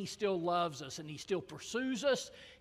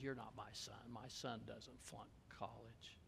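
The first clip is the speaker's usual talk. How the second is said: low, much softer, cold father; cold rejection; 8 semitones lower, 20 dB quieter than usual. Retold or imitated. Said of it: "the father looked him in the eye and says".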